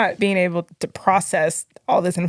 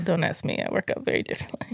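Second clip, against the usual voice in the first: whispered aside; 9 dB quieter, faster than usual. Real, not acted.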